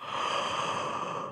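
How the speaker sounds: long breathy yawn; big pretend yawn. Shown as a demonstration. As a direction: open wide and yawn. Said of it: "Let's hear you yawn".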